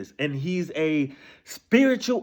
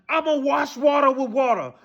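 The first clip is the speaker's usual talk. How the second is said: half-laughing, half-crying outburst; heated shout; 5 semitones higher than usual. Real, not acted. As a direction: yell it in disbelief. Heated rant, shout heard.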